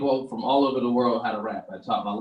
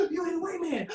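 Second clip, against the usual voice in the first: shocked bystanders; 4 dB quieter than usual. Retold or imitated. Shocked onlookers.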